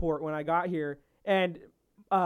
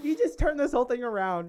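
higher-pitched imitation of mom; proud mom; 5 semitones higher than usual. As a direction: laughingly quote a proud mother. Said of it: "exactly how my mom talks".

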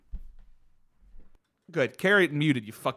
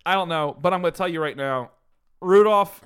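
ordinary talk

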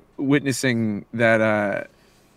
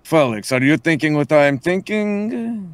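slow, conspiratorial character voice; out of the mouth's corner; 4 semitones higher, slower than usual. Acted. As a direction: sound him out slyly, drawing it out. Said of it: "out of the corner of his mouth".

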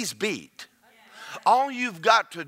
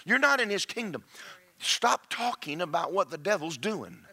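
ordinary talk

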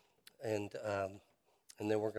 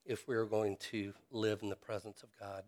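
normal talking voice

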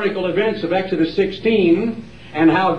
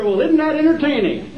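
high, laughing sarcastic question; mock viewer; 9 semitones higher than usual. Preacher mocking a TV viewer, high and sarcastic. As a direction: scoff at what you're watching. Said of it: "You sit there".

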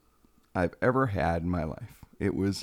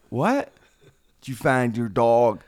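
stunned disbelief; 2 semitones higher, 6 dB louder, slower than usual. Retold or imitated. Caught off guard in the woods, he asks back in shock. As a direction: react like the news makes no sense. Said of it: "I'm like".

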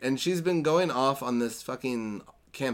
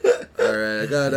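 ordinary delivery

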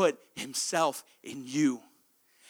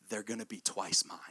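hushed whisper; 7 semitones lower, 7 dB quieter than usual. Acted. God's voice, hushed.

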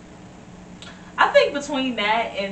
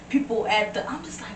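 ordinary talk, nothing marked

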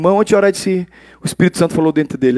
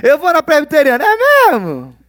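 mock churchgoer; 10 semitones higher, 4 dB louder than usual. Mimicking churchgoer small talk.

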